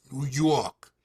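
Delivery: put-on New York accent. Shown as a demonstration. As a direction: say it like a New Yorker. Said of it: "What accent?".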